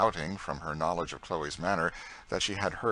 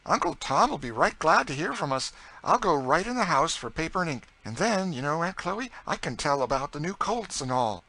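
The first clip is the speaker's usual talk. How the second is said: higher, louder, eager voice; enthusiastic young master; 9 semitones higher, 6 dB louder than usual. A narrator's reading. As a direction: promise help with keen enthusiasm. Narrator voices George.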